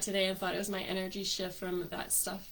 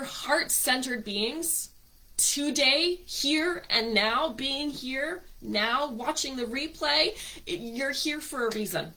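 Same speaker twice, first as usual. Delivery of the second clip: spiritual invocation; 5 dB louder than usual. Acted. Host blessing her online group, uplifting invocation. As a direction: lift the listeners into the light.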